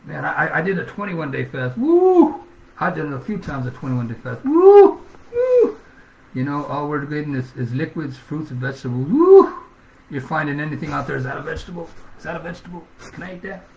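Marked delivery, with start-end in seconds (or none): 1.8-2.4 high, loud whooping woo; preacher's whoop. Acted. Recalling a hard fast. 4.4-5.8 high, loud whooping woo; preacher's whoop. Acted. Recalling a hard fast. 9.1-9.5 high, loud whooping woo; preacher's whoop. Acted. Recalling a hard fast. 11.2-13.6 softer, wondering hungry voice; a hint of a starving man eyeing food; lightly voiced hungry faster asking.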